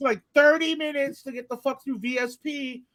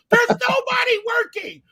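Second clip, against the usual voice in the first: slow, high, yelled in disbelief; outraged shout; 12 semitones higher, slower than usual. Real, not acted. Friend vents that nobody is working there, outraged shout. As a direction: bellow it at nobody in particular. Closed bridge exit rant.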